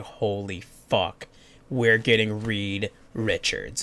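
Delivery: hushed, slow, stunned; awed hush. Retold or imitated. Fan hushed in awe at a hint. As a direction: breathe it out in awe. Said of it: "and I'm like".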